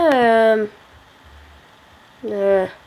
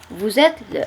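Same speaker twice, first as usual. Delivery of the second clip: ordinary talk, nothing marked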